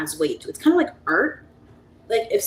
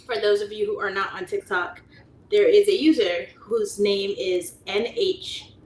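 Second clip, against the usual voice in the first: ordinary talk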